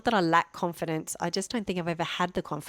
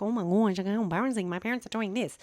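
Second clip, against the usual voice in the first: mocking children; 3 semitones higher than usual. Mother imitating her children ripping off their parents, mocking. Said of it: "all children like to rip off their parents and be like".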